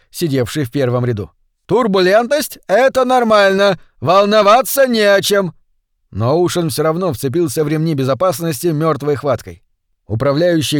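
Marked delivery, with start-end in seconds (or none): 1.7-5.5 high, raised voice over the turbulence; shouting reassurance. A narrator's reading. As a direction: shout to calm the passengers. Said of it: "прокричал губернатор Бенбридж". (успокаивающий крик на высоких нотах)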